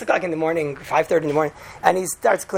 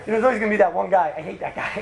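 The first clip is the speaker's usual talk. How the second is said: ordinary talk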